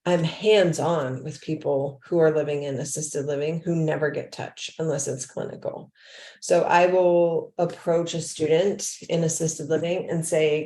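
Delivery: ordinary talk, nothing marked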